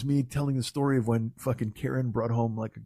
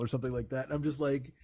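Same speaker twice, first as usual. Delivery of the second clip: ordinary talk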